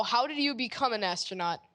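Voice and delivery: ordinary delivery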